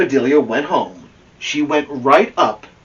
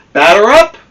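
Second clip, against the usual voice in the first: much higher, louder umpire-style call; 13 semitones higher, 12 dB louder than usual. Acted. The pitcher calls the batter to the plate, high and loud. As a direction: bellow it across the diamond. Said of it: "called the pitcher".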